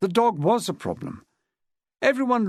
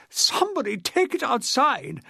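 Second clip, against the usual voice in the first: higher, slow outraged wail; shrill-voiced outrage, higher pitch; cried out; 8 semitones higher than usual. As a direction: cry out over the ruined dress. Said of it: "Miss Chilmark cried".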